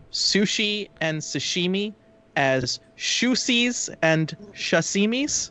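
"sushi" as "shusies", "sashimi" as "shasimis", slow; mispronounced sushi. Retold or imitated. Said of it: "they pronounced".

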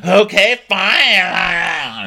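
high, loud sarcastic okay fine; mock huffy okay. Stewardess storming off, huffy okay fine. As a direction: huff it out before storming off.

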